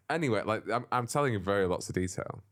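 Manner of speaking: ordinary talk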